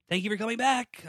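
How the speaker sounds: high, loud burst of thanks; big, performed excitement; hammed-up enthusiasm. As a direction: exclaim it like a gushing fan. Acting out an enthusiastic listener email.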